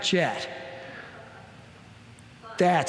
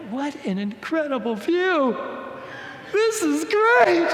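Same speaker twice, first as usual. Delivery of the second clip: forced cheerfulness; 10 semitones higher than usual. Retold or imitated. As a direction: brighten it up to break the tense silence. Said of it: "And I look out the window and I go".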